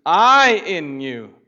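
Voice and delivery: slow, loud, each word weighted; slow emphatic proclamation. Acted. Pastor slowly proclaiming Jesus' words to the congregation.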